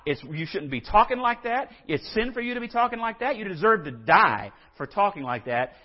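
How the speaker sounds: acted-out condemnation, hitting the first accusations hard; indignant accusation. Preacher voices critics reacting to Jesus, indignant. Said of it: "reacted by saying".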